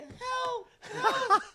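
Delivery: very high mock scream for help; imitated cry for help. Storyteller imitates the mauled friend upstairs, high screamed plea. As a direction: shriek it like the victim, half laughing.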